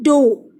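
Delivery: डो said as doe, a long o with no gh sound; model pronunciation. Shown as a demonstration. Teacher showing pupils how dough is read, clearly. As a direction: say डो like the word doe. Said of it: "हम इसको कैसे pronounce करते हैं?".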